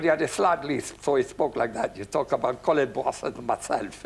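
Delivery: lightly imitated French accent; French accent demo. Shown as a demonstration. Demonstrating a Frenchman's voice to Pete, heavy French accent. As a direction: put on an overdone French accent. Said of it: "How did he s-, speak?".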